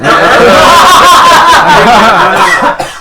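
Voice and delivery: loud high burst of laughter; roaring laugh at the joke; big loud laugh. Real, not acted. GM bursts out laughing at the table's joke.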